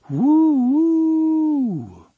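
high, drawn-out hooting whistle; train whistle imitation. A narrator's reading. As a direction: hoot like a steam train.

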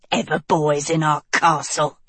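low sneering witch; low scornful witch line. A narrator's reading. Witch voice, resentful.

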